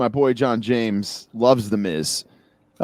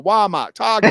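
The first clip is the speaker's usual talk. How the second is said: put-on Boston accent, the r's dropped and higher; mock Boston accent; 7 semitones higher than usual. Mimicking cohost teasing him in a Boston accent. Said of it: "you love giving me a Boston accent".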